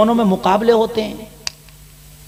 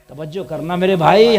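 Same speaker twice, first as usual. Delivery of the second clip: ordinary talk, nothing marked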